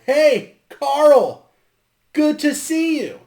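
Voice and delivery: high, loud mock greeting; playful loud call-out. Billy Madison fan greeting Carl, playful loud call-out. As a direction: holler it up high like an excited fan. Calling out a film catchphrase.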